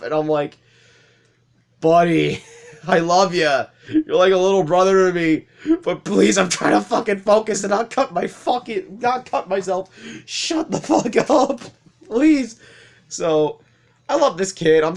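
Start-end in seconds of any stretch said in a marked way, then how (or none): 1.8-12.6 loud, high exasperation broken by laughs; laughingly beg, voice pitched up in frustration; exasperated plea. Retold or imitated. Older coworker begging a chatty kid to hush, exasperated.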